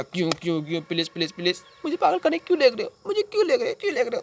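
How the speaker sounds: high, escalating desperate begging; frantic pleading. Acted. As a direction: plead in rising panic, pitch climbing sharply. Begs asylum men not to take him. (घबराकर ऊँची आवाज़ में गिड़गिड़ाना)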